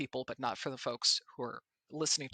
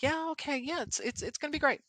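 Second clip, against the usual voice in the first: breezy confidence; 11 semitones higher than usual. Retold or imitated. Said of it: "And I'm like".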